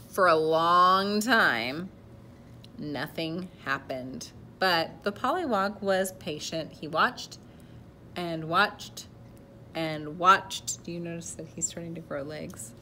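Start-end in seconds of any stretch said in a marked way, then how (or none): 0.1-10.8 slow, spaced-out storybook narration; suspenseful storytelling. A narrator's reading. Reader telling children of a patient pollywog, drawn-out narration. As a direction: stretch out the long wait with pauses. Reads a picture book aloud.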